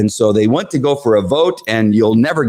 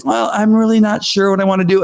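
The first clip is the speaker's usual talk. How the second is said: mock indecision; 10 semitones higher than usual. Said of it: "Marilyn saying".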